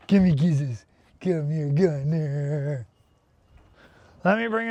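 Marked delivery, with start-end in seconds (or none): 0.1-2.9 cooing to a dog. Real, not acted. Owner sweet-talks her dog Gunner, slow doting coo.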